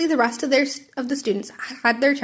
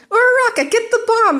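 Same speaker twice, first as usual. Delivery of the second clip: high, much louder shout; yelled; 12 semitones higher, 6 dB louder than usual. Acted. Midoriya shouts to Uraraka as he flies past. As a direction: call out across the room.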